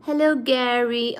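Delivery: ordinary talk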